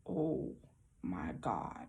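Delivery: very low, hushed disbelief; stunned hush. Retold or imitated. Telling viewers she found the feather, stunned hush. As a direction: drop to a near-silent, awed murmur.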